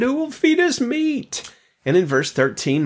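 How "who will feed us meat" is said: high, slow whining plea; high drawn-out whine for meat; whiny complaint. Retold or imitated. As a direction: whine it like a spoiled crowd begging. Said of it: "complained and said".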